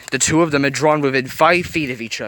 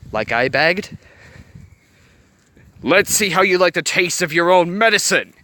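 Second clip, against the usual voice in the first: louder, higher snarling threat; snarled; 7 semitones higher, 4 dB louder than usual. A narrator's reading. Anger taunts the villain, in a loud snarling rage. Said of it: "Anger snarled".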